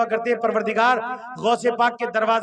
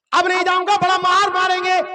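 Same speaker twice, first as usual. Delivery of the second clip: insistent refusal; 7 semitones higher, 5 dB louder than usual. Acted. Guest insists, fearing a beating.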